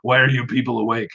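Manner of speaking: a hint of a grumpy old man. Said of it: "says in an old man voice".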